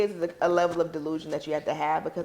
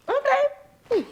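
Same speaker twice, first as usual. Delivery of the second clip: sly knowing okay. Retold or imitated.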